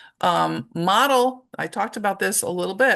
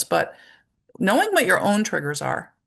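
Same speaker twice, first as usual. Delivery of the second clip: ordinary talk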